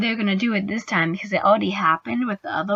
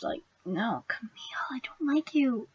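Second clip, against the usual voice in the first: whisper; 3 semitones higher, 9 dB quieter than usual. Real, not acted. Hushed aside about hated character.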